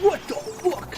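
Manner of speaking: shocked. Real, not acted. Shocked outburst at game.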